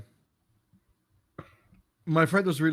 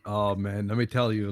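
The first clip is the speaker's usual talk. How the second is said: ordinary delivery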